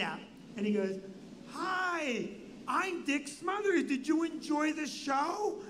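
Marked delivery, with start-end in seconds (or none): 1.5-5.6 slow, higher, oddly drawn-out showman greeting; Dick Smothers. Retold or imitated. Comedy star greets a fan backstage, weirdly slow. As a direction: put on a high, deliberate, weird entertainer voice. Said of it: "how the Smothers Brothers are talking".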